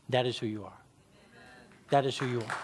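low, soft, solemn delivery; hushed affirmation. Real, not acted. Preacher assuring the congregation of who they are, hushed. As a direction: drop low and quiet to let it sink in.

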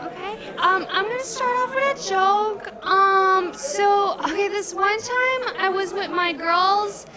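put-on very high-pitched girly stand-up voice; first-time comic in a high, put-on voice; gushing amateur comedian.

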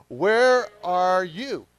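slow, loud and high call; said out loud together. Shown as a demonstration. Pastor leading the congregation to say it aloud, slow and loud. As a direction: stretch out where, calling it together. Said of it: "Let's say that out loud together".

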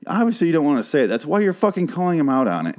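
fast, high-pitched rant; real exasperation. Real, not acted. Host arguing with news reporters about their wording, exasperated. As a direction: argue it hotly, rushing the words. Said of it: "it really does bother me".

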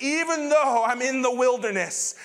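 impassioned cry of faith; defiant faith. Acted. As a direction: proclaim it against the hardship. Acts out David declaring faith.

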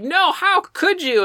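high, slow, hammed-up wail; mock heartbroken cry, drawn out high. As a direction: wail it like a wounded teen. Retelling Jessica's tearful line.

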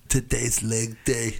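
mock Arnold. Acting out Arnold fighting Satan.

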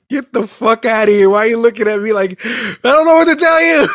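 high, loud and incredulous, rising to a squeal; exasperated mockery. Mocking customer's blank stare.

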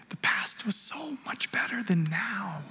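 slow, tempting whisper; hushed voice of the past, not a shout; sinister whisper. Pretend. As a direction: whisper it like the past luring you back. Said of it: "the past shouts at us".

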